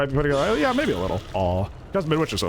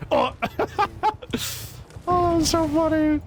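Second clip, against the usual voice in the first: laughing. Real, not acted. Laughing after funny play.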